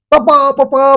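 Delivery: high, eager call of papa; पापा पापा, called high and bright. Retold or imitated. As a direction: call out to dad excitedly. Child calling father.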